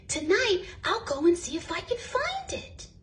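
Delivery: a small bear making a brave plan; bear's little voice. A narrator's reading. Corduroy voiced resolving.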